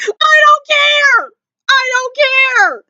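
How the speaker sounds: high, drawn-out wail; over-the-top fangirl protest; worked-up insistence. Real, not acted. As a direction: wail it slowly, stretching each word. Ranting over and over in excitement.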